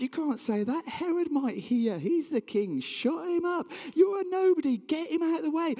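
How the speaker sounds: high, urgent orders from a crowd; agitated silencing. Acted. Crowd wants the beggar out of the way, agitated and high. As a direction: rise into indignant, high-pitched commands.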